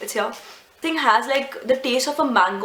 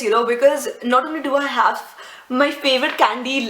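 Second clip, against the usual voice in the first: ordinary delivery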